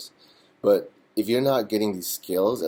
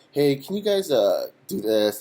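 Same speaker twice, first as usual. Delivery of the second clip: bossy request; 7 semitones higher, 6 dB louder than usual. Retold or imitated. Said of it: "And next thing I know".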